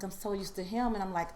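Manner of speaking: ordinary talk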